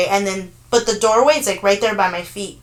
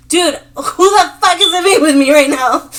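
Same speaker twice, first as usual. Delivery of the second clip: loud, higher-pitched mock freak-out; retold alarmed outburst; 5 semitones higher, 7 dB louder than usual. Retelling the reply to a friend about a ghostly boy, alarmed and loud. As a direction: act out being freaked out, loudly.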